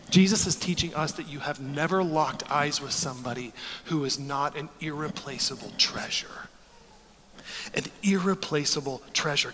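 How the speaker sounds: soft, low near-whisper; softly whispered reassurance; hushed and tender. Real, not acted. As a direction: drop to a hush on treasure. Tender climax of sermon.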